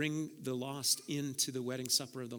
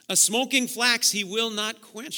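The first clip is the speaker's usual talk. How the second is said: loud, raised "A smoking flax he will not quench" ending high; emphatic repeat; 6 semitones higher, 9 dB louder than usual. Shown as a demonstration. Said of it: "Think about that".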